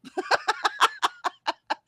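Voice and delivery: long, squealing high laugh. Real, not acted. Host joking about rats and cats, squealing laugh.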